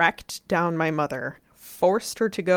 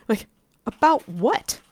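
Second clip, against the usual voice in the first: higher-pitched incredulous questioning; 5 semitones higher, 3 dB louder than usual. Retold or imitated. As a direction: throw it back as an absurd question. Retold incredulous reply to mom.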